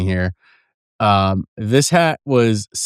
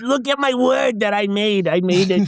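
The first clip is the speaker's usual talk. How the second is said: higher, slowed mock-proud voice showing off a word; mock proud creator; 9 semitones higher, slower than usual. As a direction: gush like a kid showing off something they made. Mocking self-proud voice.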